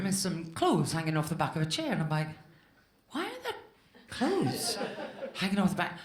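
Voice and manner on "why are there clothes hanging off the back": high, bewildered disbelief breaking into a laugh; baffled. Retold or imitated. Retelling finding clothes in her hotel room, baffled squeak. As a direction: squeak it out in confusion.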